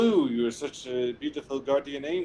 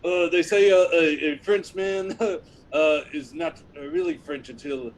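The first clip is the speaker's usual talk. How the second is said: put-on louder, laughing French accent; a hammed-up Frenchman boasting in a French accent; 9 dB louder than usual. Frenchman explaining serenades in a laughing French accent. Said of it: "I love your accent".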